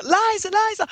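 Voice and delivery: very high frantic name calling; imitated paparazzi shouts. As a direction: mimic photographers clamouring for a star. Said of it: "all of a sudden it's like".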